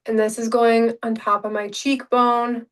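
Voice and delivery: usual speaking voice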